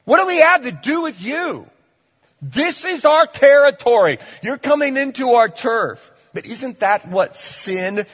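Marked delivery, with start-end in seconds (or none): 0.0-1.7 very high, loud indignant cry; demons defiant. Acted. Demons crying out at Jesus, loud high indignant. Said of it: "that's what these demons are saying to Jesus". 2.4-6.1 high, loud warning; guard your turf, threatening; demons territorial. Acted. Demons warning Jesus off their turf, loud high threat. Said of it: "that's what these demons are saying to Jesus".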